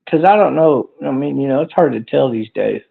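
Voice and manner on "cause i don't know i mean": normal talking voice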